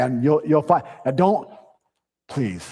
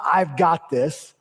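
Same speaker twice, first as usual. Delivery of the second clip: drawn-out "I've got this"; slow stubborn claim; much slower than usual. Shown as a demonstration. Pastor mocking self-reliance, slow and stubborn. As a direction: stretch it like a proud refusal. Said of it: "the strongest thing you can say as a man is not".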